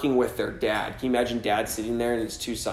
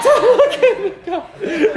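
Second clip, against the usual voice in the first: very high, loud comic shriek; mock panicked squeal; 8 dB louder, slower than usual. Preacher playing himself hiding from God, mock panicked squeal. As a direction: squeal it out in hammed-up shame.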